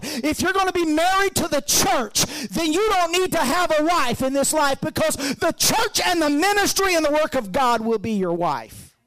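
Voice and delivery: high, loud, building preacher's cry; preaching at full force. Real, not acted. Pastor exhorting the church on marriage, in a loud preaching cry. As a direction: ramp it up like a revival preacher.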